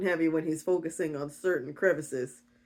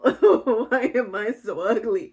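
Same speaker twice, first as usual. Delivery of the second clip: loud, slow mock wail; hammed-up self-pitying sob; poor little victim; 3 semitones higher, 5 dB louder, slower than usual. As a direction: whine it out through a laugh. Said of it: "poor little Orpheus".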